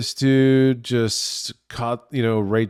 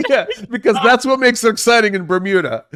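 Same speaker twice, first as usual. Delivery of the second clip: laughing; 11 semitones higher, 6 dB louder than usual. Real, not acted. Laughing at Phoebe joke.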